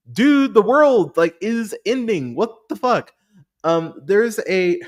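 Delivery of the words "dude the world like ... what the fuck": incredulous viewer. Retold or imitated. Viewer reacting in disbelief.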